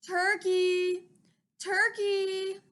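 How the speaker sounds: high, sugary coaxing call; Mister Moose sweetly calling to Turkey; sweetest voice. Acted. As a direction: sweet-talk the turkey out of its nest. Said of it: "in his sweetest voice".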